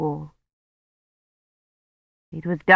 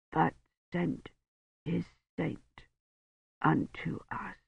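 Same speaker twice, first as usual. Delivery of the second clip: slow, much softer, thick-tongued words; blurred dying voice; 14 dB quieter than usual. A narrator's reading. Dying Roche speaks to Kivrin, slow, soft and blurred. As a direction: speak through a swollen tongue.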